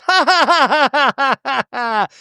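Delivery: high, loud hammed-up cackle; mock cackle. Heather cackling after her bikini answer, hammed-up. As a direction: cackle it big and fake. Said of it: "then she goes".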